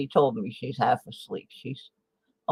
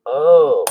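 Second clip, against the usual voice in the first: loud pained groan from a hurt arm; effort grunt; 12 dB louder than usual. Real, not acted. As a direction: groan it out in real pain. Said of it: "sorry if I'm grunting and groaning".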